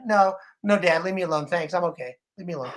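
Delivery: low, mumbled mock refusal; imitated reluctant kids. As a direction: brush dad off, low and trailing away. Grown kids refusing dad, retold.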